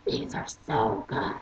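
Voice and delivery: very low, slow put-on voice; odd put-on voice. Shown as a demonstration. Praising coworker's dessert, odd deep voice. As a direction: drop the pitch way down and drag it out. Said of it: "I said it like this".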